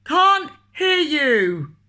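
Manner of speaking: loud, high, very slow roar. Acted. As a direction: roar it out long. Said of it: "roared the milkman".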